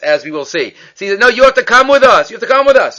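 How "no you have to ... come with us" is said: high, loud urging; insistent pleading. Retold or imitated. Student urging Elisha to come, high insistent voice. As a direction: press him to come along.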